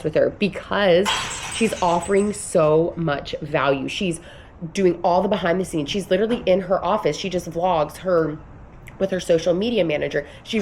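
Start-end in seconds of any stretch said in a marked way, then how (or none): none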